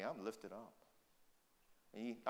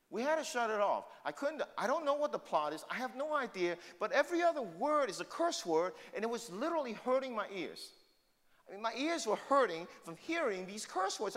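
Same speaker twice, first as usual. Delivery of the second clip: preaching; 10 semitones higher, 7 dB louder than usual. Acted. Pastor preaching to congregation.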